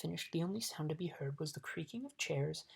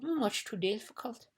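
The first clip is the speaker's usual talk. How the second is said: MUCH TOO DIFFICULT high and drawn out; emphatic; 7 semitones higher than usual. A narrator's reading. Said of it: "emphasized the bug".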